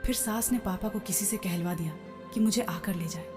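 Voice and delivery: ordinary delivery, nothing marked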